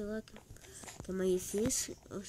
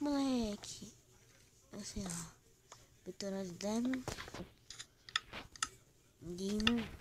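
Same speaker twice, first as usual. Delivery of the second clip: normal talking voice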